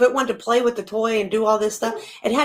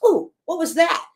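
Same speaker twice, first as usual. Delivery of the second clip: startled dog voice; 6 semitones higher than usual. Retold or imitated. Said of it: "so the dog goes".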